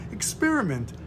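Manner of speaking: normal talking voice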